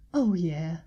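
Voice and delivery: a low sighing character voice; sighed. A narrator's reading. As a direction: let it out on a sigh. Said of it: "she sighed".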